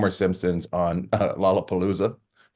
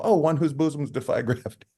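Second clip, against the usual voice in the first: cheeky quote; 8 semitones higher than usual. Retold or imitated. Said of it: "And I go".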